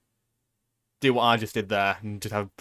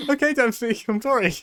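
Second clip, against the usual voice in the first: meek apology; 10 semitones higher than usual. Pretend. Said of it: "I'm like".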